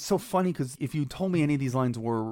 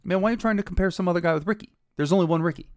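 higher, louder and fast, brushing off the comparison; cocky Rickey retort; 7 semitones higher, 5 dB louder, faster than usual. Retold or imitated. Rickey cutting off the reporter, a fast cocky higher louder retort. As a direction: fire it back fast with swagger, a touch mocking. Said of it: "cuts him off and says".

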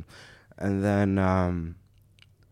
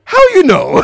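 surprised mock Italian; 16 dB louder than usual. Caught scammer, quoted laughing.

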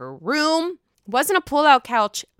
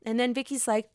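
usual speaking voice

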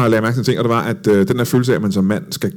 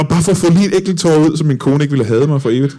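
higher-pitched pleading inner voice; mock desperate plea; 6 semitones higher than usual. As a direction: plead as if begging yourself. Egne desperate tanker gengivet. (spillet desperat bøn)

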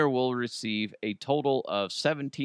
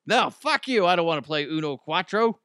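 higher, louder snap of a brusque New Yorker; rude New Yorker; 6 semitones higher, 4 dB louder than usual. Pretend. A rude New Yorker tells the Uno pitchman off, loud and high. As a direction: bite back hard, like a blunt local turning down a stranger. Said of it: "I don't know a New York accent".

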